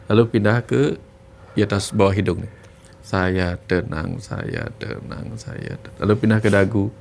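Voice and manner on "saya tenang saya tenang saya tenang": slow, much softer, breathed whisper; calming whisper. Shown as a demonstration. Coach showing a viewer calming self-talk, slow whisper. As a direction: whisper it to calm the body. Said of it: "beri perintah kepada tubuh untuk menenangkan dirinya".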